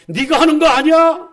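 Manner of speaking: high, loud rhetorical challenge. Real, not acted. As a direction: fling the question out at the pews. Preacher challenging congregation loudly.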